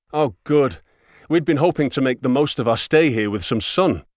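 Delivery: put-on bright and eager, a touch of an odd accent; eager foreign woman. A narrator's reading. Woman's cheerful polite reply.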